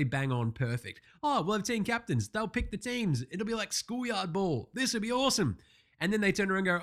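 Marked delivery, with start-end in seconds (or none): 1.2-5.6 high, eager imitation of organisers; mock enthusiasm. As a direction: mimic a gushing pitch in a higher voice. Mimics the league pitching its concept.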